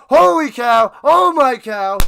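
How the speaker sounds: loud, exhilarated exclamation; amazed shout. Real, not acted. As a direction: burst out in happy disbelief. Amazed at the win.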